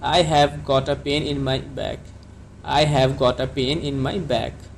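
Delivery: each word drawn out for learners; very slow classroom dictation; very slow teaching model. Shown as a demonstration. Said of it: "तो आप इसको कैसे कहेंगे?".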